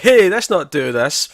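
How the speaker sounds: imitating someone calling a halt; mock calling out. Retold character jumping out to intervene.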